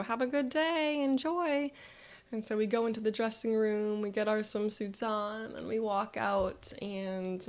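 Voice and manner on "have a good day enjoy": higher, sing-out customer greeting; cheery pool staff. Retold or imitated. Pool staff to arriving family, cheery. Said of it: "and so they go".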